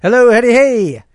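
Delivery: put-on intro voice. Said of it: "say hello in a certain way".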